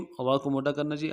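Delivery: usual speaking voice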